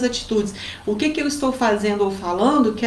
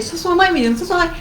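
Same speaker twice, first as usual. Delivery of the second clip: raised-pitch mock scolding of a child; teasing mum, pretending to tell off her son; playful correction; 7 semitones higher than usual. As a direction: correct the boy as a joke. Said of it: "Brincando com ele".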